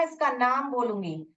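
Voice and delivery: usual speaking voice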